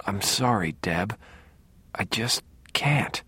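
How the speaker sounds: softly strained, falling into a whisper; struggling to speak. A narrator's reading. Brother apologising to his sister over the storm inside, struggling to speak. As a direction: force out a hushed apology, fading to near-whisper. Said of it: "fighting to speak at all".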